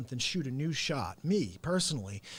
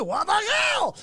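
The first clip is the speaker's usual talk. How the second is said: shrieking high and loud with Russian accent; Russian-accented scream; 7 dB louder, slower than usual. Shown as a demonstration. Repeating his old vocal bit, Russian-accented scream. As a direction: scream it upward as a comic bit. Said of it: "that was what I do".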